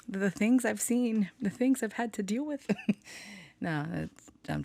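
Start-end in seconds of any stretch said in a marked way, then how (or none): 0.1-2.7 mock-tragic about the spider battles; melodramatic. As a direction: ham up the war-weary survivor. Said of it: "being melodramatic".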